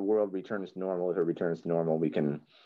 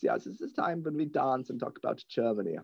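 put-on deadpan German accent, pitched up; 5 semitones higher than usual. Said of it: "Dieter from Sprockets".

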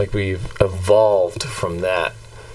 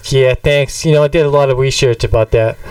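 put-on accent, pleased with himself; accented pundit voice, a little louder; Bob; 3 semitones higher, 3 dB louder than usual. Bob thanking the interviewer for praise, put-on accent. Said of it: "you sound funny".

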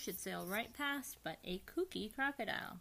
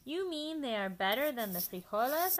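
loud, higher eager question; 5 semitones higher, 9 dB louder than usual. Acted. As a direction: ask it amazed. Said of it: "asked Skipito".

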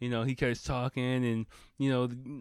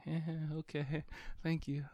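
high, soft, politely awkward chuckle; soft little nervous-polite thanks; graceful brush-off; 7 semitones higher, 8 dB quieter than usual. Shown as a demonstration. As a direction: demonstrate backing away politely. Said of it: "kinda gracefully".

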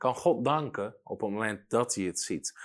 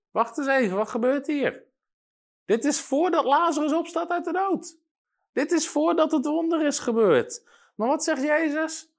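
high-pitched, puzzled interruption; mock astonishment; 4 dB louder than usual. Preacher halts the reading before Lazarus rises, mock astonished. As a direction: stop short in exaggerated high-voiced disbelief. Preacher feigns surprise at the verse.

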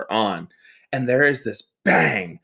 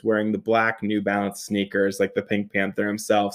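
normal talking voice

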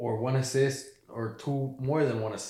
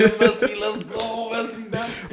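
cheering; 13 semitones higher, 11 dB louder, slower than usual. Retold or imitated. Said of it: "I was like".

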